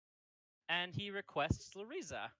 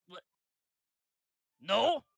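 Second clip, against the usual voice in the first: startled what; 5 semitones higher, 11 dB louder than usual. Acted. Torrin reacting in surprise.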